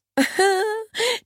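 high burst of laughing; a girl's mocking high laugh; loud laughter. A narrator's reading. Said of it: "Fee lachte lauthals los".

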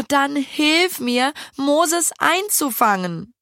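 slow, earnest request to a rival; earnest plea. A narrator's reading. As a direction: ask for help, swallowing her pride. Lille asks after deep breath.

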